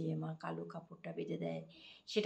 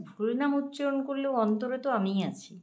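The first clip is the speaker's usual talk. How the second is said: higher and louder firm declaration; emphatic reply; 7 semitones higher, 8 dB louder than usual. Retold or imitated. The Lord answering Lakshmi, loud and firm. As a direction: declare it with conviction.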